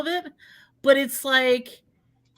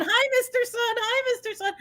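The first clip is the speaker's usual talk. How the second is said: high, sing-song puppet greeting; happy moon voice; 10 semitones higher than usual. Pretend. Demonstrating eclipse with food, moon greets the sun in a high cheery voice. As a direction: voice the cheerful little moon like a toy.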